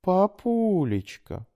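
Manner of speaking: slow, high, drawn-out coaxing; wheedling. A narrator's reading. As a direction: sweet-talk the father slowly. Daughter wheedles father.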